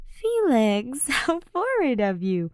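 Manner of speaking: high, giggly flirty tease; tipsy teasing. Acted. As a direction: tease him drunkenly. Drunk Ash teasing.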